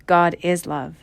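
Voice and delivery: ordinary talk, nothing marked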